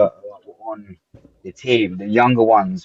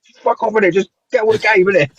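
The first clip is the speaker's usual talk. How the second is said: higher-pitched mock exasperation; hammed-up frustrated rugby mate; imitating frustrated friend; 10 semitones higher than usual. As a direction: play the flustered captain who can't find the words. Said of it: "he would just be like".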